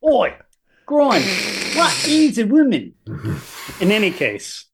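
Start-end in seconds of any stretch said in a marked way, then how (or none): 0.1-2.9 bad South African accent, pitched up; hammy fake South African voice. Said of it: "That's my terrible South African accent".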